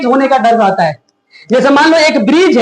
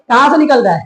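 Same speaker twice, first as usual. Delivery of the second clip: ordinary talk, nothing marked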